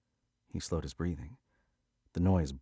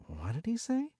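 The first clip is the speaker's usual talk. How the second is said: puzzled question. A narrator's reading. Confused question.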